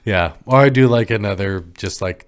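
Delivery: ordinary talk, nothing marked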